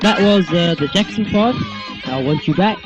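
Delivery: ordinary talk, nothing marked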